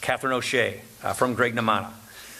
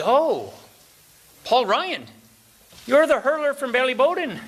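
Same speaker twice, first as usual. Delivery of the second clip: higher, louder, rising excitement of a recognizing stranger; imitating an eager local who thinks he knows you; excited Irish pub fellow; 9 semitones higher, 3 dB louder than usual.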